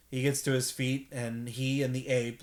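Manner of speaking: usual speaking voice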